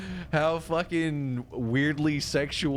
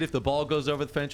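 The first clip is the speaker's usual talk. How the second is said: ordinary delivery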